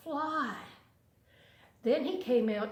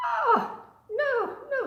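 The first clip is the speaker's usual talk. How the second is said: a tiny fly, much higher and louder; very high fly squeak; 6 dB louder, slower than usual. A narrator's reading. Fly turning down the spider's pantry, squeaking high and loud. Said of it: "said the little fly".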